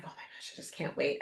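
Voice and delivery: breathless whispered excitement, fast; excited whisper. Retold or imitated. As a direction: hush it out like a giddy secret. Retold own excited whisper.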